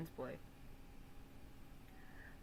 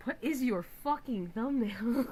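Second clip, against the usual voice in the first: louder and higher, slow indignant demand; mock outrage; 5 semitones higher, 8 dB louder than usual. Artist quoted scolding about the thumbnail, mock outraged. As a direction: bellow it in fake outrage. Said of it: "she shells up".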